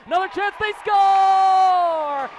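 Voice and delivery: slow, high, loud stretched score; long goal yell. Real, not acted. Goal call, score held long.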